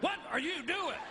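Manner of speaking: high indignant woman's yelp; startled woman. Retold or imitated. Wife yelping at her husband jerking her underwear, high and indignant. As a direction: squeal it like an offended wife. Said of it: "the women are like".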